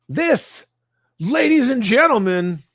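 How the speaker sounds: showman announcer. Acted. Streamer introduces the next topic to chat, as a loud ringmaster. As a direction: present it like a ringmaster.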